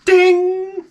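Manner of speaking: sung high ding like a chime. Pretend. Ad bell chimed high to open the fake commercial. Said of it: "we have advertisements in the show".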